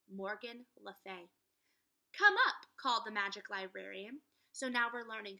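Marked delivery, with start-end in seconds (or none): 2.1-2.7 high, loud welcoming call. Acted. Morgan calling Jack and Annie up, loud call. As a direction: call them up from the window.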